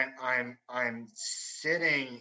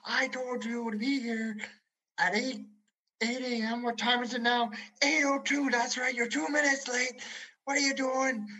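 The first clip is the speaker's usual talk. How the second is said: angry officer; 11 semitones higher than usual. Retold or imitated. Officer berates the recruit for being two minutes late. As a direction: chew him out.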